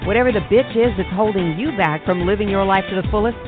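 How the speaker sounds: normal talking voice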